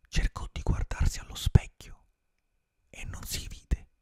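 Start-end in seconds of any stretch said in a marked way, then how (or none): none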